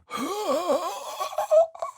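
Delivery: high, startled gasp; sudden intake of breath; sharp gasp. Acted. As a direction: gasp in shock.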